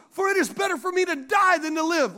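high, emphatic mock complaint; hammed-up woe-is-me protest; upset. Preacher reading Jonah's plea to God, upset. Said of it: "Jonah's upset".

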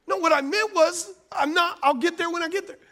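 high-pitched acted self-defence; defensive. Dad explaining what he meant on the phone, defensive.

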